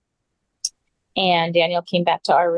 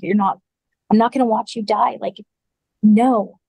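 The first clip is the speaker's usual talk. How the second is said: raised, insistent mock yell; retold scream; 3 semitones higher, 3 dB louder than usual. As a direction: put your foot down, ending on a louder no. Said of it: "I was screaming at him".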